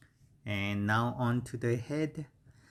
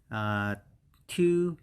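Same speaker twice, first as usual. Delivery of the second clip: ordinary talk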